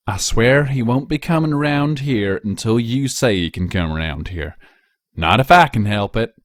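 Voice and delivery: earnest country pony bargaining; Applejack voice. Acted. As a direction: reassure her stubbornly but kindly. Applejack's voice in story.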